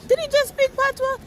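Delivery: stunned, high-pitched double take; astonished reaction. Retold or imitated. Woman overhearing a passer-by's patois, astonished. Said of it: "And I was like".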